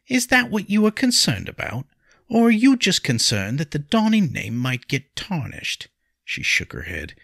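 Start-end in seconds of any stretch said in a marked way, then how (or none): 0.1-5.9 high, loud indignant questions; accusing. A narrator's reading. Wife accusing Henry over the family name, indignant. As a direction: challenge him with sharply raised pitch.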